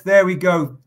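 ordinary talk, nothing marked

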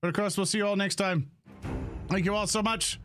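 ordinary talk, nothing marked

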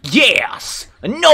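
loud, hammed-up heroic shout; exaggerated game-character cry; Captain Falcon. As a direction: bellow it like an over-the-top game hero. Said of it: "He sounded like Captain Falcon right there".

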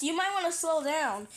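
ordinary talk

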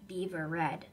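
ordinary delivery, nothing marked

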